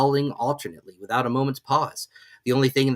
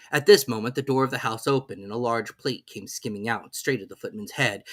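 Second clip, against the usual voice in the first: ordinary delivery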